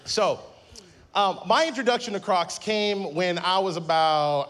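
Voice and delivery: ordinary talk, nothing marked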